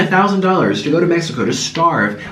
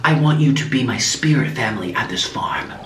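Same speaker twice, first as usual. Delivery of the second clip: hushed mock-mystical guru, partly whispered; crazy German lady; 2 semitones lower, 4 dB quieter than usual. Crazy German lady recruiting a spirit family at her farm, hushed mock-mystical. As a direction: imitate a cult-like healer breathing out solemn promises. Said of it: "she says".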